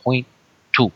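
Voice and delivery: put-on playful Mexican Spanish accent, Chicano slang. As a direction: lean into a Mexican accent like a homie greeting the crew. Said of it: "my husband's, um, interesting accent".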